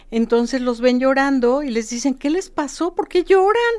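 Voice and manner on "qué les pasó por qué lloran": high, loud surprised question; curious passerby. Retold or imitated. Passersby ask the grieving family, loudly surprised. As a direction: call out to the grieving family, puzzled.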